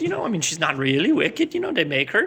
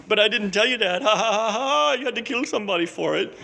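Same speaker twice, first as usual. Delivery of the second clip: mock taunting witch; 4 semitones higher than usual. Witch of the North taunting Dorothy.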